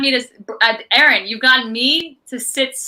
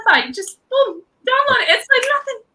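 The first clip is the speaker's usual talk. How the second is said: climbing higher, ending in an excited shout; excited gush; 12 semitones higher than usual. Real, not acted. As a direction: rave about it, voice soaring up. Gushing about the podcast.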